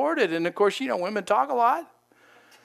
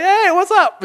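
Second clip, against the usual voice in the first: high, loud mock hey-there; exaggerated casual greeting; 12 semitones higher, 9 dB louder than usual. Greeting a startled pastor in the sanctuary, exaggerated casual hail. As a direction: pop up with a hammed-up high hello.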